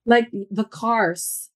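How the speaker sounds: "cars" drawn out slowly with a pronounced R; American R. Shown as a demonstration. Partner pointing out her American accent, slow "cars" with a strong R. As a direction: stretch "cars" and sound its R the American way. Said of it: "you're pronouncing your R's".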